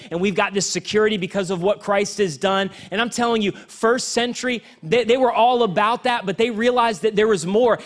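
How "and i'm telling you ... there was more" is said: preaching at full force. Acted. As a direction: preach it out to the whole church, building.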